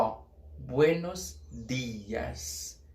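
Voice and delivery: buenos dias drawn out very slowly in Spanish. Shown as a demonstration. Teacher models buenos dias very slowly. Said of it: "Não é dias".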